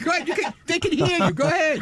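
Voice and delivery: high-pitched, insistent prodding; impatient urging. Real, not acted. Staffer telling a guest at the mic to go ahead, high impatient urging.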